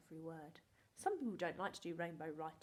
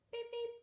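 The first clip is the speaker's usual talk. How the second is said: high loud beep beep like a horn; car horn beep; 6 dB louder than usual. Pretend. Teacher honking for the class, high and loud. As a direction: honk like a little car.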